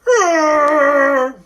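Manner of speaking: high, loud mock scream; mom's freak-out scream. Mom freaking out for the kids, high mock scream. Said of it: "Can you guys freak out with me?".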